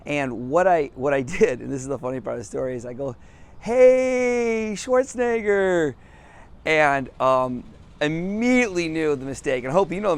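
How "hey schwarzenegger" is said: loud, high, drawn-out holler; high, stretched-out excited call. Retold or imitated. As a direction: hail a celebrity down the sidewalk. Retells calling out to a star.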